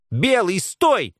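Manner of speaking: high, loud, drawn-out command; frantic yell. A narrator's reading. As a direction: yell to stop an attack. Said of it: "истошно завопила Белка". (истошный вопль)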